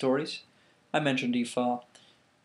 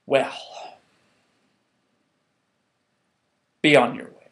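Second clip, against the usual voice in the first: weary disgust; 2 semitones higher, 4 dB louder, slower than usual. Acted. Obatala soaked a third time.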